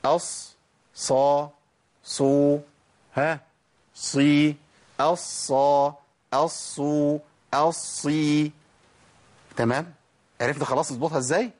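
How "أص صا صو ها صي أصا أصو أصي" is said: each ص whistled and emphatic, doubled in أصا، أصو، أصي; حالات نطق الصاد. Shown as a demonstration. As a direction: recite أص، صا، صو، صي as a level drill. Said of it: "يبقى اقول ايه بقى". (ترديد تعليمي للصاد بكل حركاتها)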